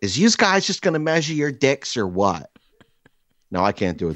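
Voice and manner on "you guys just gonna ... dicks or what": higher-pitched attempt at a Delco accent; badly faked Delco stepmother. Stepmother cutting into the men's argument, bad Delco accent. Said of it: "most horrific Delco accent I've ever heard".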